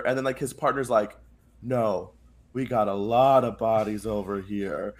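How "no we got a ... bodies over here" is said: lower, slow, understated soldier voice; imitating a grim partner; mock grim reply. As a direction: drop it low and draw it out gravely.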